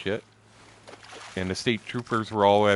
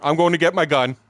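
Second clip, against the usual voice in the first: shouted angry threat; loud threat; 7 semitones higher, 6 dB louder than usual. Retold or imitated. Big guy in a parking lot threatening, loud angry shout. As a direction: bark it like a man about to fight.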